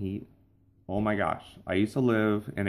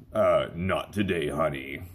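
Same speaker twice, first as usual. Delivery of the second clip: slow, low announcer voice refusing; husband answering his wife in voiceover tone; stuck radio voice; 4 semitones lower, slower than usual. Pretend. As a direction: turn down the chore in the smooth radio voice. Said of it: "your voice is stuck".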